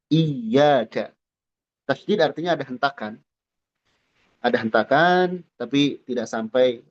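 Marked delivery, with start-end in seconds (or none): 0.1-1.1 iyyaka with a short firm jolt on the doubled y; correct iyyaka. Shown as a demonstration. Teacher gives the correct iyyaka after the wrong one. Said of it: "kita bacanya dengan tasydid".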